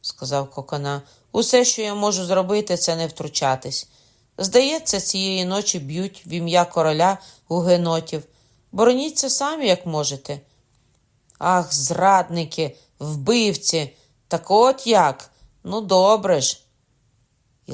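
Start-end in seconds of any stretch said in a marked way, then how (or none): 1.3-10.4 loud refusal. A narrator's reading. Kokona refusing, tense. 11.4-16.6 outraged shout. A narrator's reading. Lamol outraged at betrayal.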